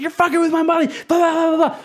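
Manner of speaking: high-pitched mock scream of rage; retold screaming. Enraged street performer attacking a co-worker over money, screaming. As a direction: screech it like someone losing it completely. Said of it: "starts screaming at me".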